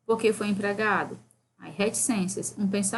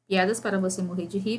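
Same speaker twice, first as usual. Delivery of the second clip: ordinary delivery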